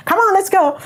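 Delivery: high, brisk retold call to follow; urging along. Guide urging her along.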